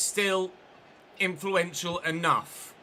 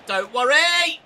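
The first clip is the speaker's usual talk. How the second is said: "Don't worry!" shouted high and loud; loud, higher repeat; loud exclamation; 8 semitones higher, 8 dB louder, slower than usual. Real, not acted.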